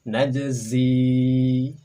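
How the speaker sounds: Najzi loud, the j bouncing; Arabic demo. Shown as a demonstration. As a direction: model Najzi for the class. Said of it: "Berikutnya silakan".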